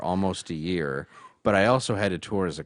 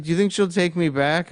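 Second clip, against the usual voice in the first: mock tearful plea; 9 semitones higher, 4 dB louder than usual. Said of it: "this enormous guy is crying".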